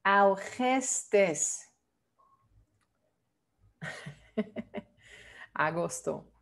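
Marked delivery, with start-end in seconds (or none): 0.0-1.7 algestes, slow, "ow-KHES-tes"; correct Dutch version. Shown as a demonstration. Teacher showing the month "algestes" in Dutch, slow "ow-khes-tes". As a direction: sound out "algestes" slowly with short E vowels. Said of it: "Tú tienes que pronunciarlo así".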